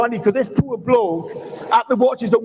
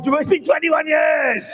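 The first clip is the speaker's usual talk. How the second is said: dramatic slow exclamation; 4 semitones higher, slower than usual. Acted. Acted dramatic wake-up reveal.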